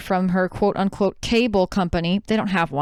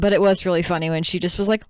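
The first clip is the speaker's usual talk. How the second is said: usual speaking voice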